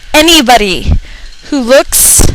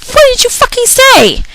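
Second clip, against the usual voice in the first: very high, shocked retort; incredulous outburst; 13 semitones higher than usual. Retold or imitated. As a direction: blurt it out in disbelief.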